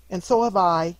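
put-on high, loud child chiming in; eager loud agreement; exclaiming. A narrator's reading. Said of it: "exclaimed another".

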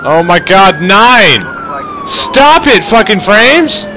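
high, frustrated exclamations; exasperated outburst. Real, not acted. Gamer at his collapsing frame rate, exasperated outburst. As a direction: cry out at the failing game, pitch jumping up.

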